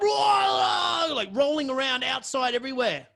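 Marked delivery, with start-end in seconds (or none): 0.0-1.1 a high, loud animal roar; wild yell. Retold or imitated. Imitating the tripping dude, a high wild roar. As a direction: bellow it like a man possessed.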